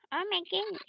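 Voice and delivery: high-pitched little exclamation; put-on character voice. Character about to become a demon, high mock exclamation.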